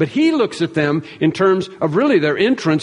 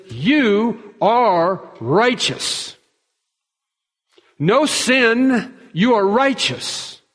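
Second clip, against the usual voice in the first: gentle declaration; 5 semitones higher, much slower than usual. Pretend. The Father declaring believers righteous.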